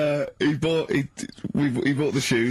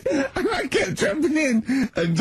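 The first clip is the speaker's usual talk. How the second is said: high, drawn-out words through laughter; slow, squeaky real laughter; laughing; 13 semitones higher, slower than usual. Real, not acted. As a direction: struggle to talk while cracking up. Cracking up over buggy antics.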